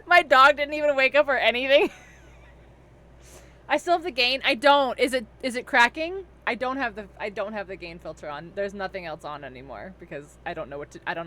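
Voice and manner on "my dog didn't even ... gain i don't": laughing. Real, not acted. Laughing loudly after raid.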